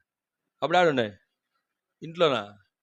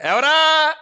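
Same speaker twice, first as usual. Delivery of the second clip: higher, louder startled call from inside; sharp shouted who; 10 semitones higher, 8 dB louder, much slower than usual. Retold or imitated. Woman inside the house hearing a knock, loud startled call. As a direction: bark out who's there. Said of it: "వాళ్ళు అట్లే మాట్లాడతారు శ్రీకాకుళం వాళ్ళు".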